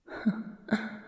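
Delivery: airy little laugh under the breath; breathy whispered chuckle. Acted. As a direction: chuckle almost silently to yourself. Vampire amused at lion question.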